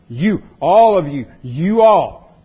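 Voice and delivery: YOU and ALL punched loud with a falling finish; emphatic plural. Shown as a demonstration. Preacher stressing the Greek plural to listeners, emphatic. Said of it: "that's where the emphasis is".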